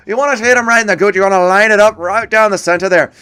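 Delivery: quick mock coaching voice; Connor coaching. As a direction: imitate a fighter's cornerman giving eager instructions. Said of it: "Impression of Connor coaching Zhao".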